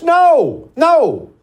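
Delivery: loud, high-pitched shouted rejection; emphatic refusal. Real, not acted. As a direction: shout it down sharply. Rejecting crying after the mock sob.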